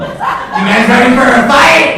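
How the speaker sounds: high, loud laughing hype; hyped-up challenge. Real, not acted. Teasing two groups of girls in the crowd, hyped. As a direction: egg the two groups on like a fight announcer.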